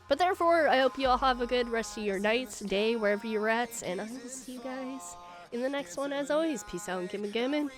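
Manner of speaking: ordinary talk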